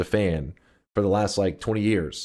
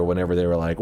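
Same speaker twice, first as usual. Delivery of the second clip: ordinary delivery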